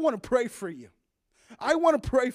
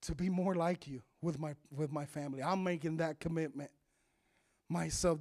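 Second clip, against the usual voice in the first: quiet prayer; 8 semitones lower, 10 dB quieter than usual. Shown as a demonstration. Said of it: "and say".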